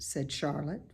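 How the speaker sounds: plain reading voice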